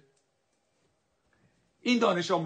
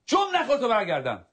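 high, loud shout for silence; angry order; 9 semitones higher, 5 dB louder than usual. Acted. Teacher silencing the arguing student, angry shout. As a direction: shut the class up at once.

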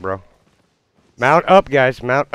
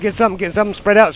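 ordinary delivery

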